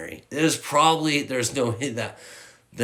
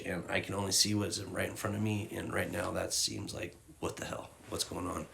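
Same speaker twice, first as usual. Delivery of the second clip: quiet crying; 10 dB quieter than usual. Real, not acted. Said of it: "that'll probably be the last time I cry".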